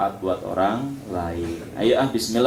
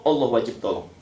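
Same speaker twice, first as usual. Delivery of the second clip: ordinary talk, nothing marked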